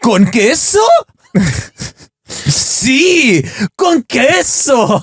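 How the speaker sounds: high, slow, over-enunciated dialogue voice; Duolingo voice. Retold or imitated. Playing both app speakers for a friend, high and slow. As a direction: act out a cheesy app dialogue. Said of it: "I love the voices on Duolingo".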